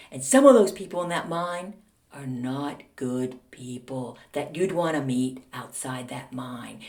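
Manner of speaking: slow, low mock menace; deliberate retold warning with pauses; veiled threat. Mother's veiled threat to teacher.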